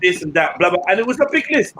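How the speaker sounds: ordinary talk, nothing marked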